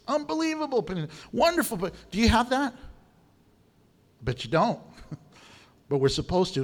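ordinary talk, nothing marked

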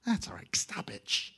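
quiet, shushing brush-off; hushed dismissal. Pretend. [name] hushing the inner voice, quiet brush-off. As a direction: wave the voice away softly.

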